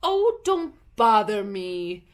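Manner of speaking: slow, loud impatient snap; an annoyed Duchess waving Alice off; irritable Duchess. A narrator's reading. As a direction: shoo away a pest. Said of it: "said the Duchess".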